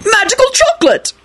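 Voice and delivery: very high panicked wail; frantic howl. Acted. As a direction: howl it desperately, pitch right up. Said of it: "Arthur howled frantically".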